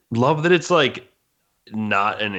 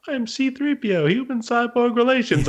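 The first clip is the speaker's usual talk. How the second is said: very high, louder droid greeting; C-3PO; 4 dB louder than usual. Retold or imitated. Tiny droid introducing itself to the Sarlacc, high-pitched impression. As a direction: pipe up as a chirpy protocol droid. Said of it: "And then all of a sudden it's like".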